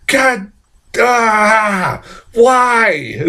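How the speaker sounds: high, drawn-out frustrated wail; hammed-up cry of frustration; furious exasperation. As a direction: groan upward in reenacted outrage. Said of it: "I was so furious".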